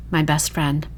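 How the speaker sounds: usual speaking voice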